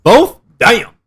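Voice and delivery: high and loud, hammed-up shock; mock surprise. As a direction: play it up as shocked. Mock dismay.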